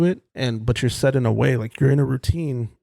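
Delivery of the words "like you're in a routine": voice cracking. Real, not acted. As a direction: voice catches and cracks. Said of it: "what's happening to my voice there?".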